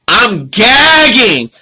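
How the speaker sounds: slow, loud, high gushing reaction; real excitement. Real, not acted. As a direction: stretch it out, overwhelmed by the kiss. Host reacts to the kiss.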